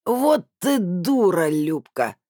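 slow tipsy slur with hiccup; drunkenly hiccup the words; drunk hiccuping. A narrator's reading. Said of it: "икнула Тамарка и пьяненько хихикнула".

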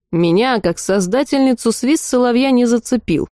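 plain reading voice

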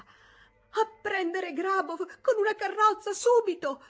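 high, loud, slow breathless urgent cry. Acted. Toni to the maid, breathless urgent shout. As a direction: gasp out the order, out of breath. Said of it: "ripeté Toni senza fiato".

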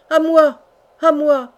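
high, loud cry after someone leaving; desperate call for help. A narrator's reading. Hardy calls after the departing king, loud cry for help. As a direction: call out loudly to bring them back. Prisoner calls as rescuer leaves.

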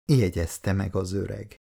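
usual speaking voice

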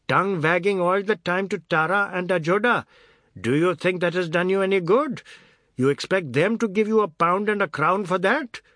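loud, high, mocking tirade; shouting. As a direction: rant at the boy, scornful. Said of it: "He was shouting now".

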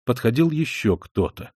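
ordinary delivery

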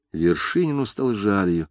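ordinary delivery

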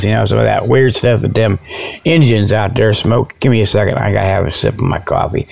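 usual speaking voice

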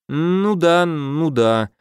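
drawn-out doubtful agreement; skeptical drawl. A narrator's reading. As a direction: sound unconvinced, stretch it. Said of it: "скептически протянул Слава". (скептически протяжно)

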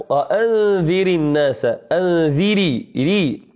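slow "وأنذر الناس" with a thin ر; light ra. Shown as a demonstration. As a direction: stretch the "ري" of "أنذري", r kept light. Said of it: "مرققة".

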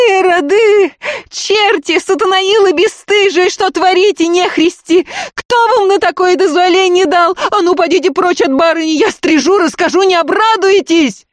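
extremely high, loud cursing shriek; piercing scream. A narrator's reading. Woman in the carriage cursing the robbers, screaming piercingly. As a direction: shriek threats without letting the pitch drop. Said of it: "таким пронзительным криком".

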